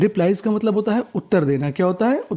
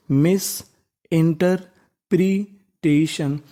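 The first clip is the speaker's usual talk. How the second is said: Interpretation drawn out, each syllable spaced; very slow read; much slower than usual. Shown as a demonstration. Teacher rereading the word with the class, very slowly. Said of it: "एक बार फिर से हम लोग पढ़ लेते हैं".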